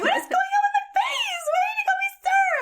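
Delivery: extremely high wailing mock distress; retold shrieky lament; highest pitched voice. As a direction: squeal it like a hammed-up meltdown. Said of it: "in probably my highest pitched voice".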